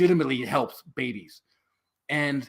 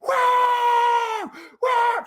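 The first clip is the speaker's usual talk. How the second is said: very high, loud mock shriek; piercing wail imitating a screaming volunteer; imitated scream; 6 dB louder than usual. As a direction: scream to drown someone out. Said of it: "one of the volunteer staff starts screaming".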